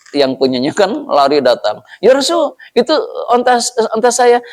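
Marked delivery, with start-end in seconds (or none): 2.0-4.4 high, urgent call of a running owner; breathless owner calling. Acted. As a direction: call out to the Prophet in a hurry. (pemilik unta memanggil dengan tergesa-gesa)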